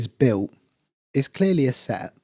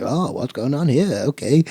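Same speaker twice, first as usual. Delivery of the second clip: put-on old man voice; 3 semitones higher, 5 dB louder than usual. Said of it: "typical old men that are like".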